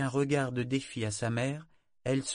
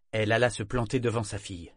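ordinary delivery

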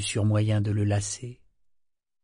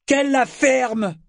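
much higher, louder, slow shout; mocking yell; shouted interruption; 7 dB louder, slower than usual. A narrator's reading. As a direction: cut her off with a bellow. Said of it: "cria Cosgo".

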